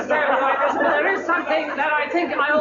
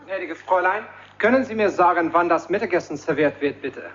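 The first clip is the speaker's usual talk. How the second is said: German phrase; 9 semitones lower than usual. Acted. Fawlty speaks German, low voice.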